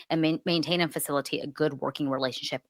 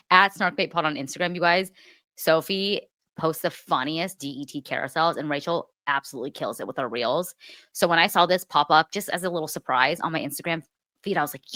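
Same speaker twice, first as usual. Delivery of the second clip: usual speaking voice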